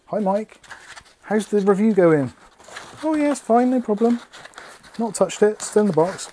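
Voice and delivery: squeaky goofy friend voice; high mock voice. Voicing Jack's chat reply.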